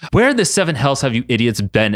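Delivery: ordinary delivery, nothing marked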